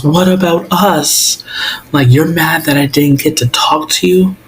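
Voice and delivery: high-pitched, wounded protest; indignant retort. Retold or imitated. Acting out Will's hurt quarrel with Mike.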